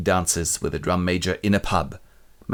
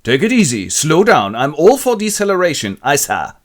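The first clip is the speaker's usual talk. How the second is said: higher, louder blustering officer; mock pompous captain; 7 semitones higher, 7 dB louder than usual. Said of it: "shaving the captain".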